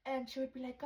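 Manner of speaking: ordinary talk, nothing marked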